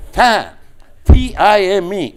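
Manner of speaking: very high, loud, slow Southern drawl spelling it out; mock Southern American drawl, letter by letter. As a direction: spell each letter like the Georgia caller. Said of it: "the Southern people have a drawl".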